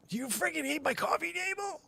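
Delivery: very high, exasperated squeal; mock outrage. Owner asking the dog about the coffee table, high mock outrage. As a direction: whine it at the dog.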